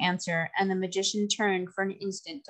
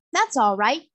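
high, loud cheery reply; cheerful; 5 semitones higher, 6 dB louder than usual. A narrator's reading. Girl Trot answering the magician, cheerfully. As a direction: toss it off brightly and unafraid. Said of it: "said Trot cheerfully".

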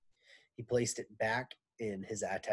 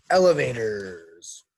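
ordinary delivery

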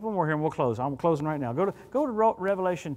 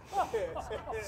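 a quiet, breathy chuckle; soft laugh; 8 semitones higher, 9 dB quieter than usual. Real, not acted. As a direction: chuckle softly at your own joke. Laughs at Yuma joke.

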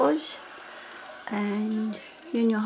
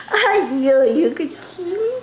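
mock whimper, higher and louder; 5 semitones higher, 6 dB louder than usual. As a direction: whine as if about to cry. Caught hiding by mom.

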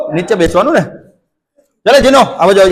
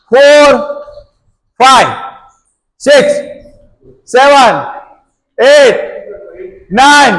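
counting workout reps; 2 semitones higher, slower than usual. Real, not acted. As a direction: call out each rep to the class. Coach counts reps aloud.

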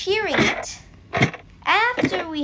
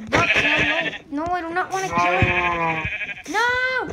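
panicked no; 3 semitones higher, 4 dB louder than usual. Real, not acted. Accidentally hitting the sheep.

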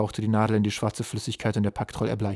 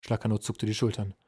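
plain reading voice

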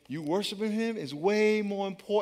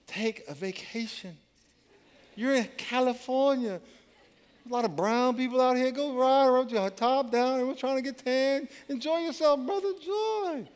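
laid-back God; 8 semitones higher than usual. Retold or imitated. God tells the player to relax, easygoing. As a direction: play God as a relaxed buddy.

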